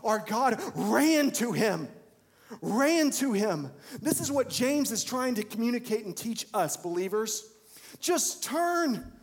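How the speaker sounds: loud, high, impassioned sermon delivery; preaching at full force. Acted. Preacher retelling the prodigal son to believers: full-force preaching. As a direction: drive it home to the congregation.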